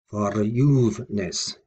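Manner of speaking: verJUVEness, slowly, juve drawn out; stress on root. Shown as a demonstration. Teacher showing the stressed root, slow stress on juve. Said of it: "the stress was on the root there".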